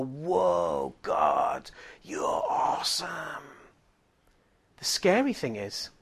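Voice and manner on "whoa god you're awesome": gaze up in hushed-pitch amazement, voiced fully; acted awe. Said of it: "it's a wonder to all of us".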